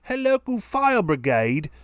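put-on British accent. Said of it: "as they say in England".

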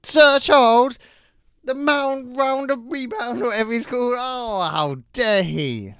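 high, loud, hammed-up indignation; mock outrage. Cohost defending Barkley from a listener, in high mock outrage. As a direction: squeal in fake offence at the slander.

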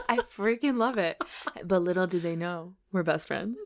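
ordinary talk, nothing marked

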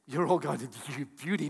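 usual speaking voice

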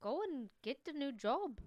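high, lilting put-on voice; mock accented scolding. Hosts playing the cook dismissing him, high mock lilt. Both mimic the cook's accent.

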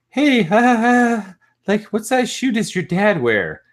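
put-on very high, loud girlish squeal; mock giggly girl. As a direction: imitate a party girl with a fake giggle. Imitates giggly girl asking prank question.

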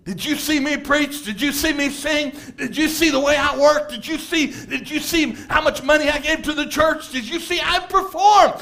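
puffed-up self-praise rising in pitch; boastful bragging. Retold or imitated. Saul bragging, pitch climbing.

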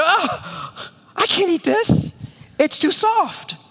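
put-on high, whining child voice; whiny toddler. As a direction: whine like a fussy three-year-old. Whiny toddler rejecting bagel.

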